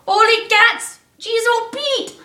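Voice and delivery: high, loud startled yell; storybook Santa yelping in pain. Acted. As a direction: yelp it out like he just got burned. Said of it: "he yelled out".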